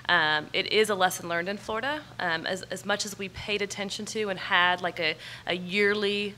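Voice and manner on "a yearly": ordinary talk, nothing marked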